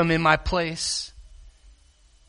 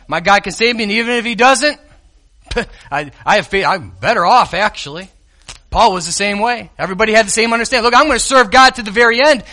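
raised, driving sermon voice; preaching; 4 semitones higher than usual. Acted. Preacher to his congregation, raised and driving.

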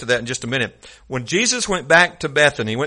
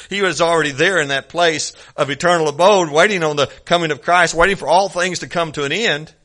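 ordinary talk

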